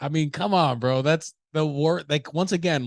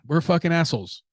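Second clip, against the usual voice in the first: ordinary talk, nothing marked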